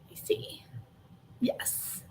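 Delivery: whisper. Real, not acted. Muttering to herself while checking Nightbot.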